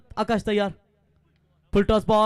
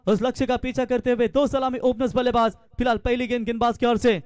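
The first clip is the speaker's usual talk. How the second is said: ordinary talk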